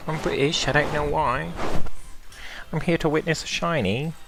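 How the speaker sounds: high, put-on British accent for the dog. Streamer voicing his dog Winifred for the stream in a high British accent. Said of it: "her Br-, accent went away".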